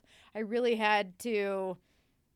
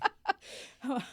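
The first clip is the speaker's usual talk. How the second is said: light laugh; 5 semitones higher than usual. Real, not acted. Laughing about going wild.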